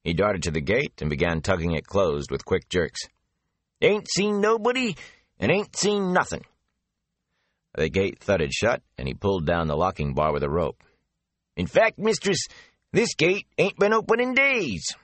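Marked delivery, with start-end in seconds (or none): 3.8-6.4 high, gossipy rustic man; chatty old gatekeeper spilling rumours; country gatekeeper voice. Acted. Avin repeats his promise. 11.6-14.9 high, gossipy rustic man; chatty old gatekeeper spilling rumours; country gatekeeper voice. Acted. Avin calls after them.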